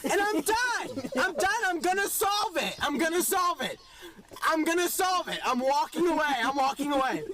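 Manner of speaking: very high, strained rant, easing slightly toward the end; hammed-up frantic frustration; exasperated meltdown. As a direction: lose it completely and storm off. Acted meltdown at security guard.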